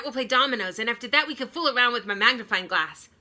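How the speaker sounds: loud, fast, breathless plans; excited Reggie. Acted. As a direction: rattle off the plans in a rush. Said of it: "Reggie excitedly lists plans".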